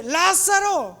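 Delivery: high, loud, drawn-out call; shouted summons. Acted. Jesus calls dead Lázaro out, loud high shout. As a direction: shout it commandingly, as if into a tomb. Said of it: "Jesús grita".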